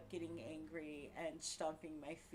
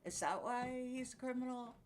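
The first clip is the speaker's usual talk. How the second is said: ordinary talk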